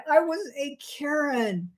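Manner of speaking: laughing self-reproach. Real, not acted.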